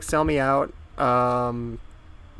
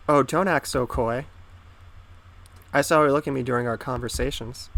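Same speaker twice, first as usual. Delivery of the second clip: ordinary talk, nothing marked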